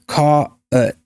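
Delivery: "caught" split as CAW-uht; diphthongized caught. Shown as a demonstration. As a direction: stretch "caught" into two vowels, aw-uh. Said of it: "a little softening of, that's the diphthongization".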